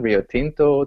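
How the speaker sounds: usual speaking voice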